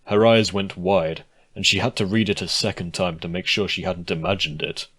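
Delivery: ordinary delivery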